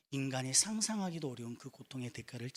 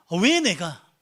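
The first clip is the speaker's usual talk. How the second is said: loud, high, protesting question; acted protest; 4 semitones higher, 10 dB louder, much slower than usual. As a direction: call it out as a hypothetical cry of protest. Acts out Jesus protesting suffering. (크고 높게 항의하듯 되묻는 연기)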